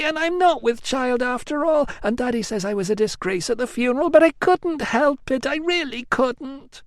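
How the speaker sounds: put-on high, plaintive young woman's wail; whiny Amelia. A narrator's reading. Amelia wailing to her cousin, high and plaintive. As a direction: wail it like a spoilt cousin begging pity.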